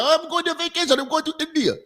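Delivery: high, soft mimicking voice; mock traveler. Mimics people announcing trips to India.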